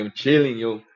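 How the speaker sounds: put-on loud street greeting, Spanish-neighborhood accent. Greeting a Spanish dude, put-on Spanish-neighborhood accent. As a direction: greet him in imitated Spanish-neighborhood slang. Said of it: "if I get around a Spanish dude".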